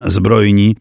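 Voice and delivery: ordinary delivery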